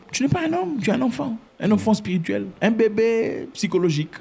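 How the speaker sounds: high-pitched, incredulous rebuke; indignant, high-flung put-down; scornful scolding. Real, not acted. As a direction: taunt them in a high, indignant voice. Scornful scolding of rootless listeners.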